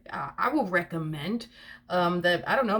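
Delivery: usual speaking voice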